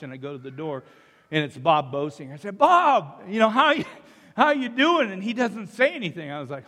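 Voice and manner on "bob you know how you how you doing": higher, louder retold hello to a friend; hearty greeting. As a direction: call it out to a friend at the door.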